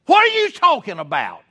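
sudden loud, high angry snap; harsh rebuke. Shown as a demonstration. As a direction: jump down his throat. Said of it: "And just jump down his throat".

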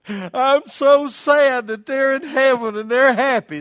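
loud, high-pitched fake wail of sorrow; mock grief. Preacher mocks grieving over parents in heaven, exaggerated loud wail.

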